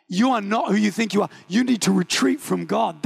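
usual speaking voice

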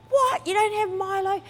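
high, indignant imitation of a rude customer; hammed-up entitled customer; mock outrage. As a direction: squeal the complaint in disbelief. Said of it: "Started yelling at him".

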